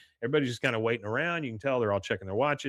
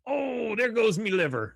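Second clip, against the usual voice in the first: high, wailing stage-Irish voice; mock Irish lament, pitched way up; Irish brogue; 10 semitones higher than usual. Leprechaun collapsing after his beating, hammed-up Irish wail. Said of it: "Bobby says".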